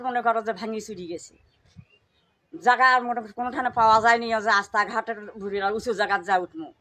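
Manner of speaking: ordinary talk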